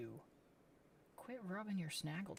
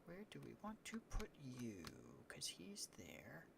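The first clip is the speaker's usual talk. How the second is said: whisper; 3 semitones lower, 9 dB quieter than usual. Real, not acted. Placing the cats quietly.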